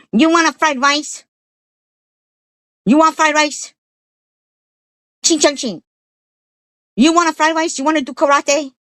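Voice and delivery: high, loud mocking Chinese accent imitation. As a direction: ham up a caricatured Chinese accent to stepkids. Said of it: "like you were mocking them".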